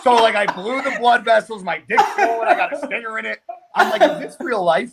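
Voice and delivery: cracking up in disbelief; laughing disbelief. Real, not acted. To friends, retelling the sting, laughing in disbelief.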